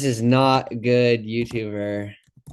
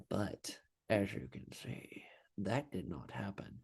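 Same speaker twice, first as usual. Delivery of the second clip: whispered aside, far softer; whispering; 5 semitones lower, 18 dB quieter than usual. Real, not acted. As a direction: whisper it like a letdown. Bias failed, voice drops away.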